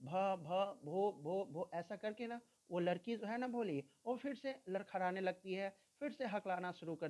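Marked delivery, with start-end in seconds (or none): 0.0-1.7 slow, halting भ भो भो with broken restarts; teacher acting out a girl's stammer. Shown as a demonstration. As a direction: stutter on भो, stuck on the first sound. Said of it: "she began to stammer".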